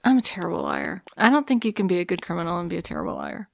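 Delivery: usual speaking voice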